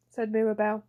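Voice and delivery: usual speaking voice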